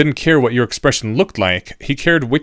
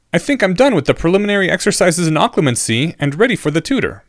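higher-pitched pupil reporting in; eager student; 7 semitones higher than usual. A narrator's reading. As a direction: raise the voice into an earnest student's.

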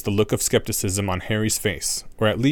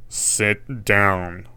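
stern teacher; 2 semitones lower than usual. A narrator's reading. Snape commands class.

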